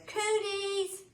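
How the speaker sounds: shrieked high and loud, a playground jeer; hollered taunt. A narrator's reading. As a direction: yell it right at her head like a bully. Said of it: "and holler".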